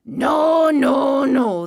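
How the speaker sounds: loud, high drawn-out no's; angry farmer chasing birds off; shouting. A narrator's reading. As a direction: holler each "no" long. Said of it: "He shouted".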